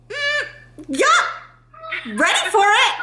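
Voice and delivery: high, strained mock readiness; feigned eagerness. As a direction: act game for it while secretly dreading it. Acts nervous agreement to intense treatment.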